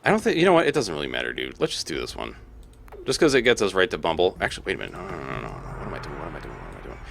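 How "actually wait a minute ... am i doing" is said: hushed, low muttering, trailing down; muttering to self. Real, not acted. Player catches own mistake mid-move, muttering to self. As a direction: mutter it under your breath, fast fading.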